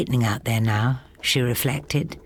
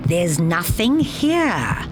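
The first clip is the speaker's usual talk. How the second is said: loudly; 3 semitones higher, much slower than usual. A narrator's reading. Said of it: "he told her loudly".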